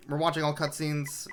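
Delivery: ordinary delivery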